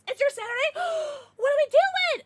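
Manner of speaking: high, loud squeal of disbelief; shocked squeal. Real, not acted. Streamer reacting to chat it's Saturday, squealing in shock. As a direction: gasp and squeal it out, appalled.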